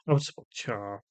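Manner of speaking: ordinary delivery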